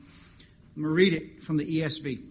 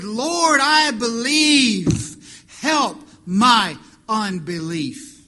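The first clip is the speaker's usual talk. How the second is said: high, loud, slow desperate plea; crying out; 6 semitones higher, 11 dB louder, much slower than usual. Acted. Desperate father begs Jesus, high and loud. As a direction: cry it out like a father in tears. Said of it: "cried out with tears".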